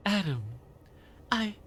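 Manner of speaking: toned-down eccentric woman; lightly voiced odd lady; conspiracy theorist woman. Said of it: "How do I do conspiracy theorist woman?".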